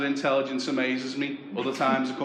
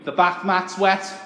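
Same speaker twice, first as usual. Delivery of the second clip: nagging mum; 6 semitones higher, 6 dB louder, slower than usual. Retold or imitated. Mum scolding in retold quarrel.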